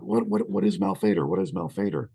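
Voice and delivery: urgent questioning. Retold or imitated. Retelling the characters' questions to the Ouija board, urgent questioning. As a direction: fire off the questions, baffled.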